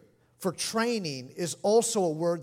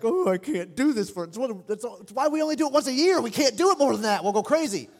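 higher, louder, fast frazzled rant; exasperated venting; 5 semitones higher, 6 dB louder, faster than usual. Retold or imitated. VBS volunteer at the end of the week, exasperated and frazzled. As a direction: throw up your hands, worn out by the week. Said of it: "you're like".

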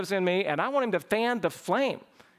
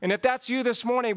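usual speaking voice

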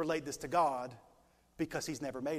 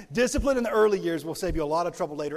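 louder, faster repeat of the line; louder repeat for amen; 10 dB louder, faster than usual. Shown as a demonstration. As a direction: say it again, demanding an amen. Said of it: "You're supposed to say amen".

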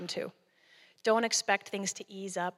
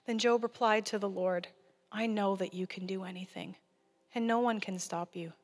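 scripture reading. Acted. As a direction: read Job with reverence.

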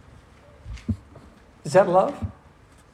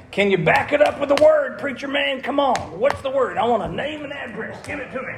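playful challenge; 7 semitones higher than usual. Real, not acted. Preacher teasingly challenging the class.

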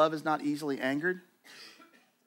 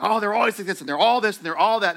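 higher, louder mock complaint; mock grumbling; 6 semitones higher, 7 dB louder, faster than usual. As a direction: sneer at everyone like a bitter grumbler. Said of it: "instead of just being like".

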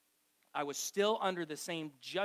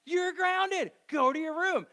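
high, loud mock furious mother; angry mom; 12 semitones higher, 8 dB louder, slower than usual. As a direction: bark the punishment like an exasperated mom. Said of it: "be like".

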